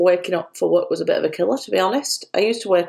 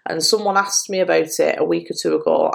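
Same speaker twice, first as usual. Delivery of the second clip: usual speaking voice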